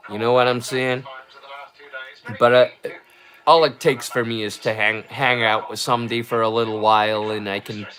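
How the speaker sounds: put-on easy general American accent. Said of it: "normal American".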